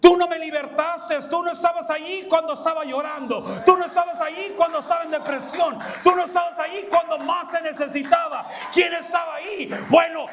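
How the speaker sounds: fervent preacher's rebuke; fast fervent preaching. Acted. Preaching at full force.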